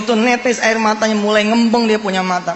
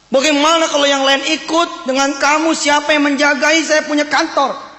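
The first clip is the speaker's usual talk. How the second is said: mock-angry boss, building higher in pitch; raised voice; 6 semitones higher than usual. Boss confronting security guard over skipped night shifts, raised voice. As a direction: scold the employee in a high, insistent voice. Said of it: "dengan nada tinggi si bos bilang".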